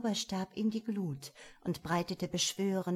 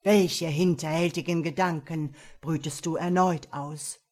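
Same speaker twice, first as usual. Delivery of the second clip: louder sly demon question; underworld god voice; 3 semitones lower, 6 dB louder than usual. A narrator's reading. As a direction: probe the apprentice slyly. Devil asks.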